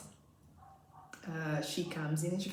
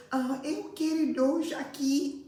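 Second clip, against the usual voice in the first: put-on child voice; 9 semitones higher, 5 dB louder, slower than usual. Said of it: "She's telling me".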